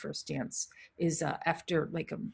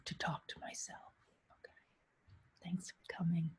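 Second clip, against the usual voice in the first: soft embarrassed whisper; 11 dB quieter than usual. Real, not acted. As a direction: whisper it like a secret aside. Whispered self-aside.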